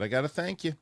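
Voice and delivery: retold sarcastic thank-you with a high lilt. Dad to daughter waking for school, sarcastic. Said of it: "I was being sarcastic".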